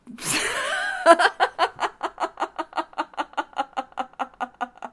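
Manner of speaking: uncontrollable giggling fit; real corpsing laugh; helpless high laughter. Real, not acted. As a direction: laugh until you can't stop. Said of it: "I just started to laugh".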